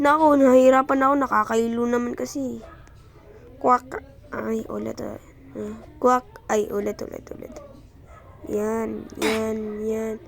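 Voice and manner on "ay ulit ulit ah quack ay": ordinary talk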